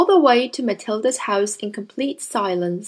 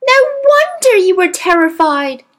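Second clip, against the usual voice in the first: higher, louder outburst of sympathy; exclaiming; 7 semitones higher, 8 dB louder than usual. A narrator's reading. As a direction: cry it out in understanding. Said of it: "Matilda cried".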